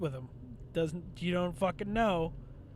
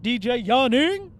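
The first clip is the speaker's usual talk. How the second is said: hype-man shout; 4 semitones higher, 9 dB louder than usual. Pretend. Hyping up a rap name.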